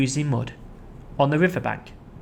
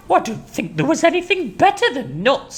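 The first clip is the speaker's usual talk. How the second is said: much higher chattering voice; cheeky monkey; 12 semitones higher than usual. Acted. Mugglewump defending nuts. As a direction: chirp it up high.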